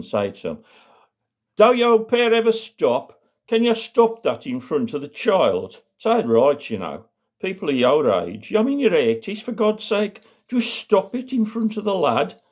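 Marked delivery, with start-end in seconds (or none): 1.6-12.4 exasperated scolding. Acted. Mum telling off an old couple in front of the child, exasperated scolding. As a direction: scold them in a high, fed-up voice.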